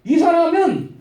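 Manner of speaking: high-pitched public proclamation, acted out; crying out. Slave girl following Paul announces him to everyone, crying out. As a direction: call it out to the whole crowd. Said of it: "뒤에서 따라다니면서 외쳐요".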